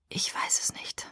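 very soft, voiceless admission; whispered. A narrator's reading. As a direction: barely breathe it out in a whisper. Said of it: "flüsterte er". (geflüstert, ganz leise)

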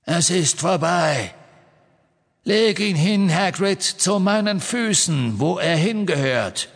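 high, slow, cold dark lord voice. Acted. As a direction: menace them slowly from above. Voldemort speaks again.

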